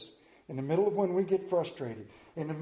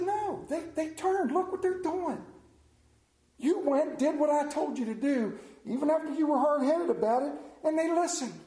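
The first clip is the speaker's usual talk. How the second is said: God voiced well above his usual pitch, patiently explaining; 9 semitones higher than usual. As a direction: play God correcting a stubborn prophet. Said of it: "And God said".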